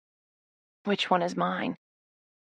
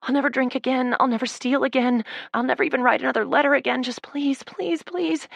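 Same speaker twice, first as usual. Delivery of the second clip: desperate pleading; 7 semitones higher than usual. Acted. Desperate prayer.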